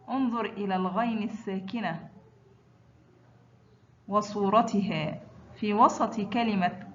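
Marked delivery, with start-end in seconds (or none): none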